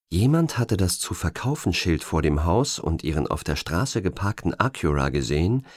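ordinary delivery

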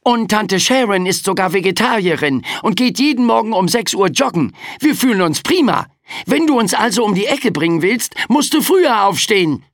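put-on high, fast-talking frightened boy; aufgeregter Junge. A narrator's reading. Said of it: "ergriff das Wort". (aufgeregter Junge mit hoher Stimme)

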